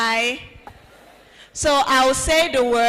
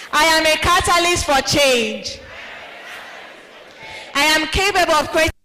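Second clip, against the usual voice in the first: firm, raised affirmation; with conviction; 3 semitones higher than usual. Shown as a demonstration. Host leading the audience in a confident affirmation. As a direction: declare it like you mean it. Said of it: "say it with conviction".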